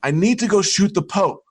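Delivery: mock yell at the annoyed boss; yelling back. Waiter yells back at his boss in the restaurant. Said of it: "I yelled back at him".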